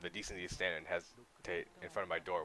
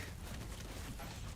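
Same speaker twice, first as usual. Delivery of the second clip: breathy disbelieving laugh; 5 dB quieter than usual. Real, not acted. Enemies flood the room.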